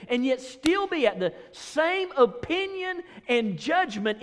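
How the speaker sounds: preacher urging the church to unity; preaching. Real, not acted. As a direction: lift it like a rising sermon. Preaching at full force.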